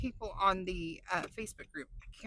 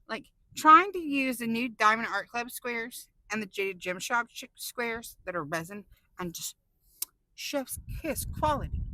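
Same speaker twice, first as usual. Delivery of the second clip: normal talking voice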